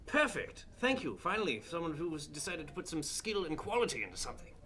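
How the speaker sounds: finally satisfied snob; delighted relief. Acted. Noble relieved by muffin.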